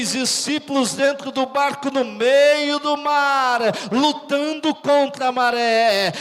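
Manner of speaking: rousing preaching. Real, not acted. Preacher tells of disciples fighting the tide, voice swelling.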